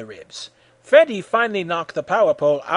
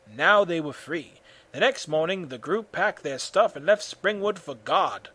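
plain reading voice

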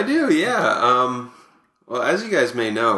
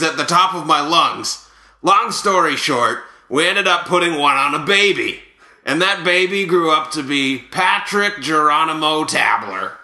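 hammed-up ballplayer raconteur, lifted pitch; animated, put-on storytelling voice; Buck Martinez; 2 semitones higher than usual. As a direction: spin the tall tale as a comic ex-catcher. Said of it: "here to present these is Buck Martinez".

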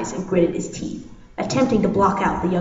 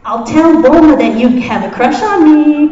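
loud, high gleeful threat; playfully taunting, raised voice; teasing with a smile; 7 semitones higher, 9 dB louder than usual. A narrator's reading. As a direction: tease it out loudly, smiling. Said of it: "Goku said with a smile".

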